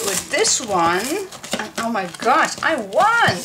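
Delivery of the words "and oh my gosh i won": shrieking jackpot winner. Real, not acted. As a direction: shout the win with joy. Announcing prize win.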